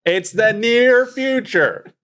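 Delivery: very high, slow, drawn-out proclamation; sing-song it high and long to brush off questions; theatrical catchphrase. Pretend. Answers doubters with theatrical catchphrase.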